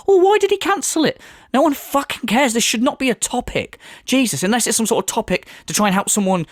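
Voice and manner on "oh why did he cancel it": high, sarcastic pleading whine; mock sleepless fan. Mocking a podcast fan kept up at night, high sarcastic whine. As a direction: whine it up high like a bored fan desperate to know. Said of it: "I'm just up all night going".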